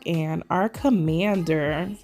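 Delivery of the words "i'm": ordinary talk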